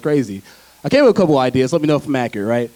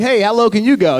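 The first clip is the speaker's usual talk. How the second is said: raised, lively challenge; limbo challenge; 5 semitones higher than usual. Acted. Onlookers challenging a limbo player, in a higher voice. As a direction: call it out higher, like a dare. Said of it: "They'll be like".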